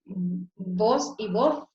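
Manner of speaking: drawn out slowly, word by word; slow demo words. Shown as a demonstration. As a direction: spell out "voz y voz" slowly for the class. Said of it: "como el caso de voz".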